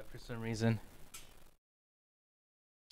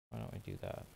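whispering; 9 dB quieter, faster than usual. Real, not acted. Unvoiced aside.

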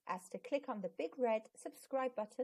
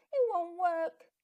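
sniffly; 7 semitones higher, 7 dB louder, slower than usual. Acted. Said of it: "Zack sniffed".

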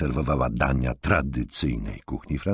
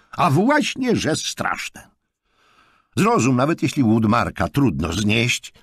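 reprimand; 11 semitones higher, 5 dB louder than usual. A narrator's reading. Jonathan lectures Markus.

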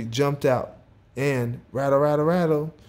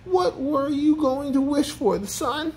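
high, incredulous character question; chiding himself; 13 semitones higher than usual. A narrator's reading. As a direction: question yourself in a squeaky high voice. Character chides himself over a wish.

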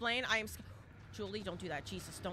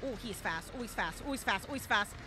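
usual speaking voice

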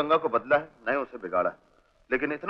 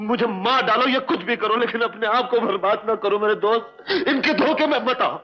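high, urgent appeal; emotional outburst, pitched well up; impassioned pleading; 6 semitones higher than usual. Acted. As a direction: beg a dear friend with all you have. Begs friend not to ruin himself.

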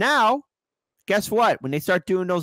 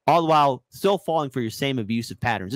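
ordinary delivery

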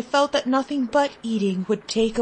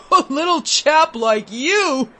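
laughing; 4 semitones higher, 4 dB louder than usual. A narrator's reading. Gentlemen mock the boy.

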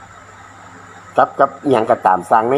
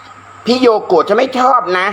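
quoting a fan; 7 semitones higher, much slower than usual. Retold or imitated. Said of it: "เธอก็เอาหน้าสดมาเลย".

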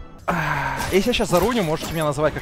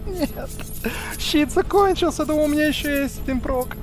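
high-pitched; 11 semitones higher than usual. Real, not acted. High-pitched frantic talk.

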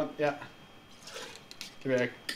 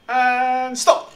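calling time; 12 semitones higher, 9 dB louder than usual. Real, not acted.

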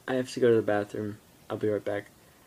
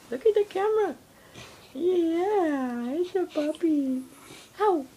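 dog voice. Real, not acted. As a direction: coo at the puppy up high. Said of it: "That's my dog voice".

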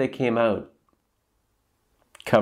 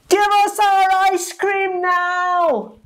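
high, loud, slow demanding chant; kids yelling together; 5 dB louder, slower than usual. A narrator's reading. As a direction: yell it as a mob of kids. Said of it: "they yelled together".

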